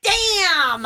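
high, loud imitated kid's holler; loudest shout. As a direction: yell it like a child upstairs. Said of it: "I hear from upstairs the loudest".

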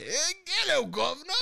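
high, hammed-up British greeting; bad British accent. Friend showing what he always says, exaggerated British accent. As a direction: pitch it way up, mock British. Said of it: "I always gotta say".

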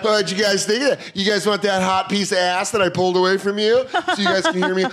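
high, hammed-up wrestler promo voice; Macho Man Randy Savage. Waiter taunting diners while clearing their plates, wrestler impression. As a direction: taunt the table like a showboating wrestler. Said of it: "pretty good impression of Macho Man Randy Savage".

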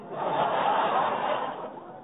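unvoiced wheezy chuckle; breathy giggle. Real, not acted. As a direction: chuckle to himself. [name] giggles at flirting.

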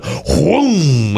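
high mock whoom as the swing hits; cartoon swoosh effect; whoosh of the ride. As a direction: sound the ride sweeping her away. Ride slams into her.

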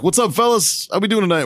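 high, jovial mock colonel greeting. Telling the story of the colonel catching them smoking, high jovial voice. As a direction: ham up the friendly colonel.

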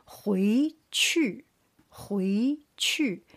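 回去 with 回 rising, 去 falling sharply; second-plus-fourth tones. Shown as a demonstration. Teacher drills second with fourth tone, 回去 rising then falling. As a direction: lift 回 slowly, then drop 去. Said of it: "second tone with the fourth tone".

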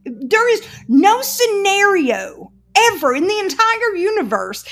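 very high, loud, laughing protest; laughing outrage. Real, not acted. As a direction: squeal it out through laughter. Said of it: "It's hilarious".

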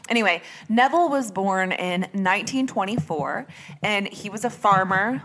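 usual speaking voice

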